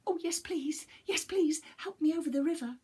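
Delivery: high, squeaky, fast panicked little voice; a tiny cookie in a flap; high panicky little gingerbread man; frightened gingerbread man. Acted.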